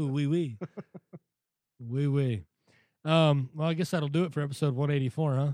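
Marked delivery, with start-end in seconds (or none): 0.0-2.4 fruity French. Retold or imitated. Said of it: "You went fruity French".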